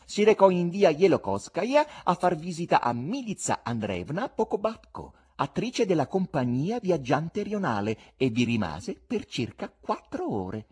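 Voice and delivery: swooping, teasing revelation; insolent buffoon. Acted. Buffoon reveals the husband's secret visit, insolent. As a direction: dangle each detail, slowing on the last one.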